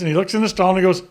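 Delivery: ordinary talk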